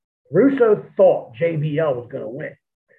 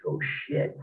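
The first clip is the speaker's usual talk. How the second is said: hushed, breathy whisper of shock; whispered dismay at seeing the open trach; whispered oh shit; 7 dB quieter than usual. Retold or imitated. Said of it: "I was just like".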